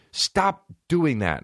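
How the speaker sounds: high, slow exasperated mock scolding; imitated scolding mom. Mom so mad at him during homework, slow mock scolding. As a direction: voice a fed-up mom, words drawn out.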